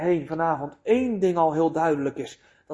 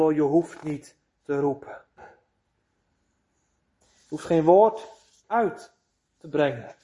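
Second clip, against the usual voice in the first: lulling devil; 4 semitones lower than usual. Acted. Devil soothes the soul again.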